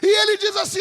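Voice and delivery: high, loud, shouted preaching; preaching at full force. Acted. Preacher to congregation, shouting at full force. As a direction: lift the church with a roaring climax.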